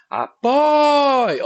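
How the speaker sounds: loud, high call stretching the stressed "poi"; calling out the word. Shown as a demonstration. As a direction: call "Apoio" out as if summoning someone. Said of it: "Você chama a palavra".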